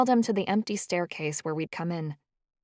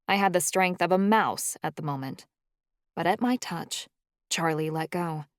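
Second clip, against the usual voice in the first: ordinary delivery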